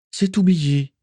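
put-on high young woman's voice mid-fight; Morgan's voice. Morgan reassuring Johan during battle, high voiced. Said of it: "assura Morgan".

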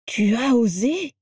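mildly choked, drawn-out question; choked disbelief. A narrator's reading. Crabbe confronts Vera over the stolen letter, choked disbelief. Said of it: "s'étrangla Crabbe".

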